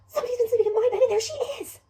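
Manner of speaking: very high, loud excited cub squeal; Baby Bear. Acted. Little bear discovering the sleeper in his bed, high and shrieking. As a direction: squeal it out like a shocked small child. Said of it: "Baby Bear comes over and says".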